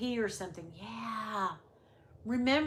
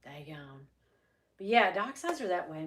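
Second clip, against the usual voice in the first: normal talking voice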